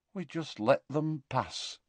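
quiet, empty and drained; hollow voice. A narrator's reading. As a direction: let the words fall hollow. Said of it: "said Tonks in a hollow voice".

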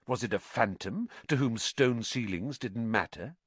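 usual speaking voice

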